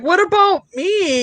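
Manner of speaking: high, drawn-out complaint; indignant whine. Retold or imitated. Daughter left out when dad pours beers, indignant. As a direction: stretch it out like someone left out.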